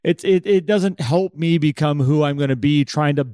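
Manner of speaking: ordinary talk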